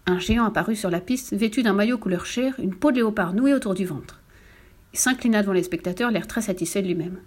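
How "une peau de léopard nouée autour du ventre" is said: ordinary delivery, nothing marked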